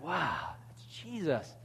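hushed pretend amazement; mock awe. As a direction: act softly awestruck. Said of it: "We'd be like".

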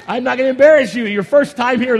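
loud, high reassuring call across the room; playful shout. Real, not acted. Pastor calling to a first-time visitor, loud and playful. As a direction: call out so the newcomer hears.

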